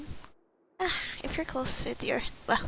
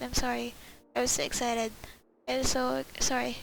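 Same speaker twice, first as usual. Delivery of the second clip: ordinary talk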